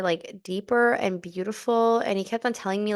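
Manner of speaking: ordinary delivery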